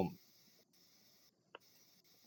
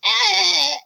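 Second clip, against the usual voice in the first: very high little squeal; baby creature cry. Acted. Game master voices the kappa baby, high squeal. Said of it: "The Kappa baby just is like".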